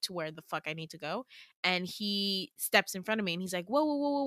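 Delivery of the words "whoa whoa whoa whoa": higher, rapid string of whoas; alarmed halting. Acted. Damien steps in front of her to stop her, alarmed halting. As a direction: try to halt someone hurrying past.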